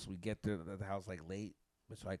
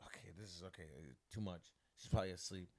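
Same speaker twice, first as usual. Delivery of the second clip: resigned self-talk; 7 semitones lower, 8 dB quieter than usual. Retold or imitated. Talks himself down after unanswered call.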